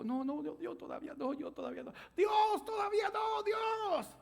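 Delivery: hammed-up begging that builds to a shout; mock pleading cry. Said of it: "Y empezó a llorar y dijo".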